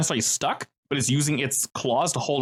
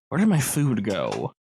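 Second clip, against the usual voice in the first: slower, lower hint of a beast; a hint of a hungry creature; lightly voiced monster; 4 semitones lower, slower than usual. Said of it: "crystalback wider ... begins looking around".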